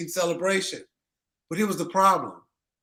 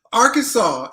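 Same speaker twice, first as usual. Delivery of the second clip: high, loud Arkansas; loud scolding call; exasperated call; 7 semitones higher, 8 dB louder than usual. Real, not acted. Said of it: "calling out the state, exasperated".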